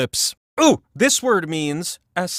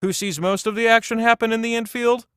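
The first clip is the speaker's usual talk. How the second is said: normal talking voice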